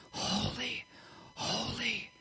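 hushed, unvoiced whisper; reverent whisper. Shown as a demonstration. Preacher dwelling on God's holiness, reverent whisper. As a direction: whisper each word slowly apart, in awe.

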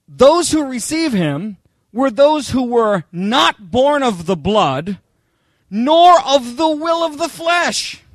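slow, rising scripture read building to a loud finish; preaching scripture. Acted. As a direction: preach the verse, build to God. Reads scripture with preaching force.

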